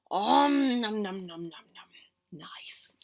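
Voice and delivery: fading greedy om nom nom; munch it, then sigh nice; munching noises. Acted. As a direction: nom noises trailing to a whisper. Eating cake greedily.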